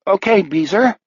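quavering high nervous reply; shaky nervous falsetto. Acted. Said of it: "Moon says in a shaky voice".

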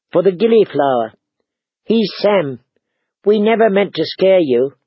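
lightly voiced girl, apologetic; lightly acted apologetic girl; timid young girl. A narrator's reading. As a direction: explain gently in a lighter voice. Girl with baby explains.

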